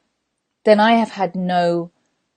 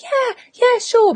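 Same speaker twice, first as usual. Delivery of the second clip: very high-pitched obliging agreement; fake eager yes; 4 dB louder, slower than usual. You agreeing to someone's plea, fake eager. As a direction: squeak a falsely willing yes.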